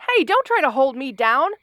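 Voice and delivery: ordinary delivery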